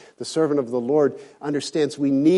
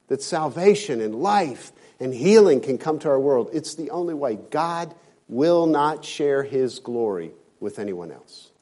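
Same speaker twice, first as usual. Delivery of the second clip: usual speaking voice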